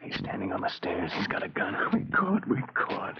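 whisper. Acted. Panicked whisper to accomplice.

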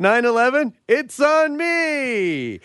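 high, stretched-out host call; drawn-out stage catchphrase, pitched high; mock emcee shout. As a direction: holler it like a cheesy club comic. Plays a karaoke emcee, calling out.

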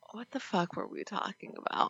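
quick whispered aside; whispering. Real, not acted. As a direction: breathe it under your voice. Aside mid-conversation, dropped voice.

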